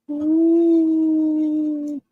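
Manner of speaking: high gleeful woo; excited whoop. Real, not acted. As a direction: whoop on the jump. Jumping off the roof.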